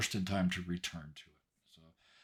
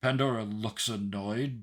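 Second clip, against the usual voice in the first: normal talking voice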